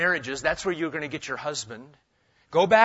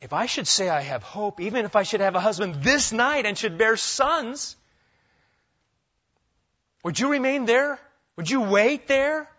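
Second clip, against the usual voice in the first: pleading questions; 5 semitones higher than usual. Retold or imitated. Naomi's pleading rhetorical questions.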